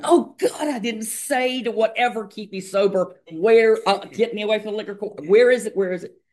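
high-pitched frantic re-enactment of panic; mock panic. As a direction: act out a desperate, high-pitched scramble. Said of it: "jump in panic".

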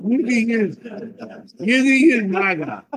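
high, loud caricature of a zealot; mock AA zealot. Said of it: "all these A A Nazis do, right? They're like".

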